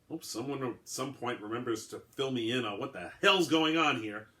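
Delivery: ordinary delivery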